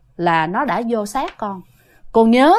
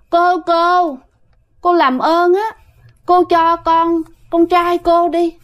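soft, coy plea, voice a little higher; a possessing spirit begging sweetly; gentle, coaxing ghost voice; 5 semitones higher, slower than usual. Retold or imitated. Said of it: "nói nhẹ nhàng lắm nghe, mà dẹo còn hơn Thi nữa".

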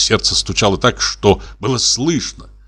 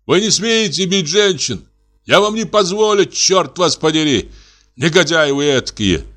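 high outraged protest; indignant shout; 7 semitones higher than usual. Acted. Artist shouting at men beating women, high outrage. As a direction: shout down the brutes in fury.

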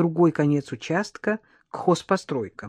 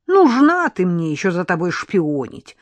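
snorting scoff; 7 dB louder than usual. A narrator's reading. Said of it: "фыркнула Мария".

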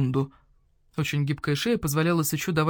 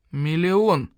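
curt, hard, louder single word; harsh; 4 dB louder than usual. Acted. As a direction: cut off the haggling flat. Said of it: "жёстко сказал барсукот".

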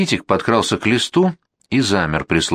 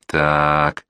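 ominous drawl; 4 semitones lower than usual. A narrator's reading. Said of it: "зловеще протянул за Витькиной спиной Фуня".

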